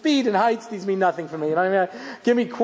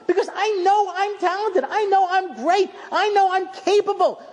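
put-on high, pleading child insisting he is capable; insistent child; 8 semitones higher than usual. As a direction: insist like a kid who wants to be trusted. Child insisting on his talent.

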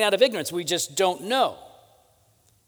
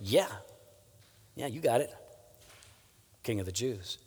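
low, softened, offhand confirmation; understated, matter-of-fact yes; quiet admission; 7 semitones lower, 10 dB quieter than usual. Acted. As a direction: admit it quietly, dropping lower toward the end. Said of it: "So he actually admits, he goes".

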